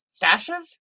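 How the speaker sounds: stashes lifted high with a rising tune; rising emphasis. Acted. Savoring the word stashes to viewers, rising high. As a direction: pitch stashes up, like a question.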